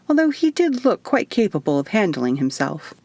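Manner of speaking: ordinary delivery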